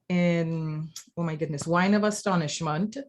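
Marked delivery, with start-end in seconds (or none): none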